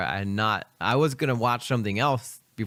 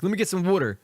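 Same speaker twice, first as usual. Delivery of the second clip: higher-pitched mock Philly accent; hammed-up Philly order; 8 semitones higher, faster than usual. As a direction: play up the Philly accent like a local. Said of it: "drop the accent".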